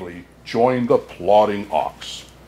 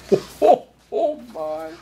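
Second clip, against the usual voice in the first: jolly chuckle. Acted. Santa chuckling at his tale.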